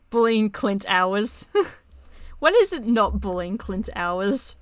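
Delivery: loud teasing. Real, not acted. Group teasing Clint.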